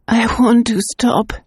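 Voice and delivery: a hint of rasp as she begs; hoarse. Governess begging the clairvoyant to stop, hoarse. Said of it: "she said hoarsely".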